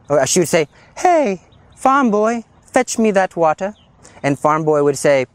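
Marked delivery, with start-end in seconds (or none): 1.0-3.7 high, slow mock-royal call to the servant; bossy princess. Princess calling her servant to fetch water. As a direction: order the farm boy about like a spoiled princess. Princess ordering the farm boy.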